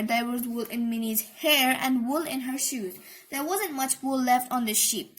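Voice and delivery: ordinary delivery, nothing marked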